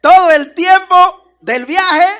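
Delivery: slow, loud, outraged protest; indignant outcry. Real, not acted. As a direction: protest in disbelief, dragging it out. Indignant at the passenger.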